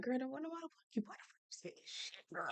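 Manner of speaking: hissed, unvoiced aside; soft mock whisper. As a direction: whisper it like a shocked sister. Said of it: "her sister was looking like".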